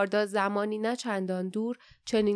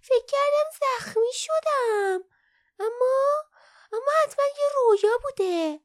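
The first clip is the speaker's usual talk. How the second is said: very high little creature voice; breath caught in shock; 6 dB louder than usual. A narrator's reading. Young Kin finding no wound, astonished, high-voiced. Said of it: "نفسش بند آمد و آهسته گفت".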